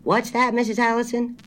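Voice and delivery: high-pitched call down the phone; loud. A narrator's reading. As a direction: ask loudly for a repeat. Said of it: "Mister Babcock said loudly".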